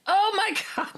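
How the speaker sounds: realizing the break-in is real; startled gasp. Retold or imitated. Woman waking to intruders, shocked and high-pitched. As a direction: pitch jumps way up on oh my God, a laugh breaking in. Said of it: "And I was like".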